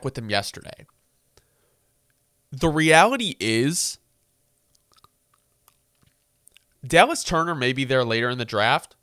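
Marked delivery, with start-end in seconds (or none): 2.5-9.0 talking around chewing gum; words slurred by a wad of gum; gum in mouth. Real, not acted. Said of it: "I got a piece of gum in my mouth".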